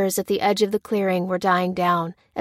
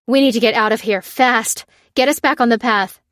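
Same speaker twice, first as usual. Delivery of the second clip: loud, pressing order; raised voice, urgent and commanding; 3 semitones higher, 6 dB louder than usual. A narrator's reading. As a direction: hurry everyone out of danger. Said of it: "urged Lucy".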